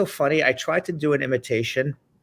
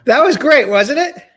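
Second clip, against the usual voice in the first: much higher and louder, bursting with delight; thrilled; 10 semitones higher, 8 dB louder than usual. Real, not acted. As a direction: gush about how great it went. Host thrilled after interview.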